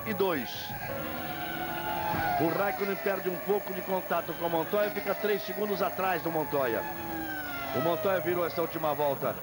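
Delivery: live race play-by-play; brisk broadcast lap-by-lap call; motorsport commentator. Real, not acted.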